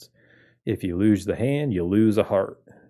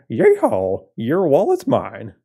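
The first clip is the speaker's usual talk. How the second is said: loud yeehaw cowboy holler; 5 semitones higher, 6 dB louder, slower than usual. Acted. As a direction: whoop it like a rodeo cowboy. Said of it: "what's a cowboy sound like?".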